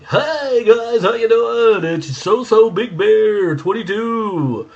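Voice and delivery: loud, high, drawn-out announcer parody; mock fake radio inflection, belted out slowly; fifties radio voice. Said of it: "nineteen fifties radio voice with this fake inflection".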